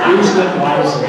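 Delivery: loud, punched mock order; mock shouted warning. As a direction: bark it like a stern editor. Editor's warning after career quip.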